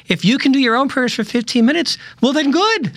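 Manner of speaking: high, dismissive mock reply; wry retort. Quoting his comeback to skeptics, high and wry. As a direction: toss the objection back, lightly amused. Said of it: "And I say".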